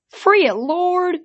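high, slow, loud horse-creature plea; pleading. Acted. Hippocampi begging the hero to free the calf, pleading. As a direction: beg desperately in a strange creature voice. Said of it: "they pleaded".

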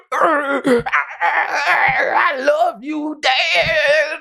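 high, slow, sugary mock tearful son; milk it like a cheesy reunion; sappy son. Fan mocking the sappy line he expected the son to say. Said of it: "That's what I was expecting".

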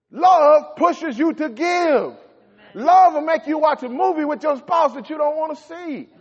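loud, high, driving sermon voice; preaching at full force. Acted. Preacher driving home love to his congregation, loud and fervent.

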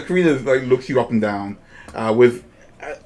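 ordinary delivery

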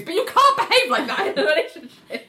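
high, loud laughing retelling; laughing while quoting the lawyer; laughing quote. Retold or imitated. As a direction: laugh through the quoted line. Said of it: "reopens it and goes".

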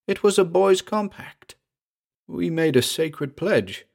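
high-pitched, urgent confession; agitated recollection. A narrator's reading. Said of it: "Marriott was talking rapidly now".

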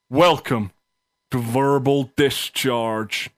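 slow, drawn-out grand welcome; grand announcement. Acted. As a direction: unveil the show name slowly. Grand slow show title reveal.

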